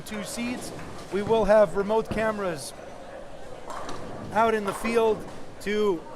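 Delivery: loud upbeat stream host; announcer voice. Acted. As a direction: project like a live broadcast announcer. Stream host addresses viewers.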